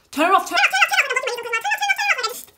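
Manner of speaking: high-pitched, loud mock panic; frantic pleading. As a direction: reenact a desperate plea in a rapid burst. Reenacts begging to switch off TV.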